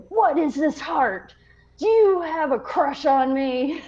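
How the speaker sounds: high, teasing drill officer voice; mock officer. Officer teasing recruit about the heart, hammed-up high. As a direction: ham up the officer's accusation. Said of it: "he's like".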